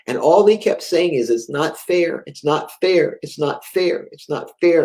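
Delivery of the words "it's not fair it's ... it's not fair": ordinary talk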